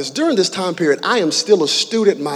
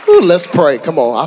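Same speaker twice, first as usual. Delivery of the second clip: ordinary delivery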